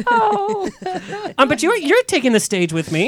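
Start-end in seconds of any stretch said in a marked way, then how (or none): 0.1-0.7 disappointed oh. Retold or imitated. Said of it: "You're like".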